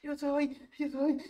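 high mock cry; retold shouted reply. Initiate answering the shouting group, high mock shout. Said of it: "Te empiezan a gritar".

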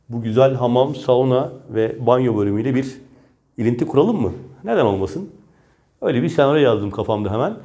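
usual speaking voice

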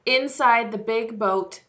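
usual speaking voice

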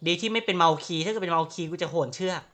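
normal talking voice

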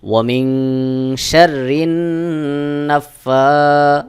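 very slow chanted recitation with ghunnah; slow Quran recitation. Shown as a demonstration. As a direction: draw out each word in tajweed. Recites the verse. (ধীরে তাজবীদসহ তিলাওয়াত)

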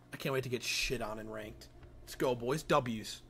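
usual speaking voice

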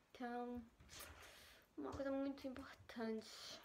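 whispered aside; soft unvoiced murmur. Real, not acted. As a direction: drop to a whisper. Whispered aside while washing dishes.

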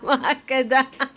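amused, laughing through the words; chuckling as you speak. Real, not acted. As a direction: let real laughter break up the line. Amused reply, laughing along.

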